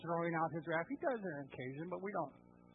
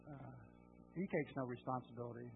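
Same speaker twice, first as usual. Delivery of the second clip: ordinary talk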